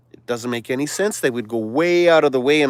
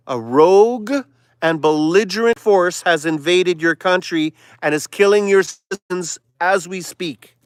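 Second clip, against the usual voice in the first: normal talking voice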